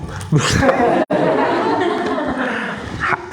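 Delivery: a burst of high laughter; high, airy laugh; real laugh. Real, not acted. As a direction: break into laughter at the memory. Laughs at own story.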